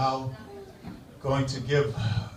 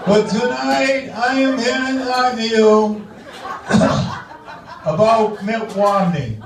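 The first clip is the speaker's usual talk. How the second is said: Menino impression; 4 semitones higher than usual. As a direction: stumble through a speech like Mayor Menino. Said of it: "my tribute to the outgoing mayor, Tom Menino".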